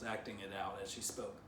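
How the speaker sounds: plain reading voice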